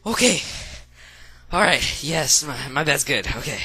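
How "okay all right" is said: high, loud frantic panic; phobic panic. Real, not acted. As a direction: get it away from me, panicking. Panicking over sparking phone.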